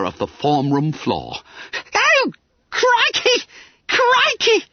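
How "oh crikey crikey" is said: very high, drawn-out squeal; spluttered shock. A narrator's reading. Bunter sees himself in the mirror, spluttering. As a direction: wail it in horrified astonishment. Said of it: "spluttered Bunter".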